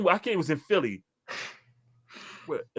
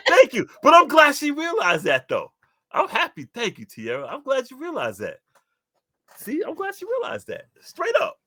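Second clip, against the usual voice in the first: high, loud gleeful thanks; excited thanks; 13 semitones higher, 5 dB louder than usual. Real, not acted. Vindicated by a friend, excited and high. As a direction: crow happily that you were right.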